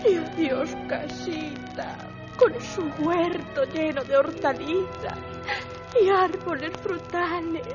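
put-on weepy girl bidding goodbye; tearful milkmaid. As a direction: sob through the farewells. Milkmaid weeps her losses. (lecherita llorosa)

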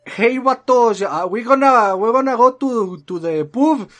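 hammed-up vato inviting the guys out; mock Chicano accent. (imitando un acento chicano exagerado, mucho más agudo y fuerte)

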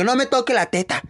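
high mock shriek to the audience; a hammed-up high yell. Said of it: "Y gritaba a todo el público".

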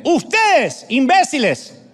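high, loud call with a rebuke on imbéciles; scolding shout. Acted. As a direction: yell the insult down at the crowd. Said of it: "Está hablando la sabiduría, no yo". (grito agudo y regañón: Ustedes, imbéciles)

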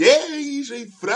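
high, loud cheerful hello; excited shout. Retold or imitated. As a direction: burst in loudly to introduce. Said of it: "pues, y".

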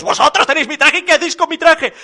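high, loud, rapid questioning; outraged disbelief. A narrator's reading. Papá Noel confronting the piglets over his suit, high outrage. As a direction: fire both questions off, appalled.

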